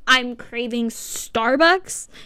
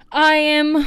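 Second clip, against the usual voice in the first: grand announcement; 5 semitones higher, 6 dB louder than usual. Pretend. Grand self-announcement as queen.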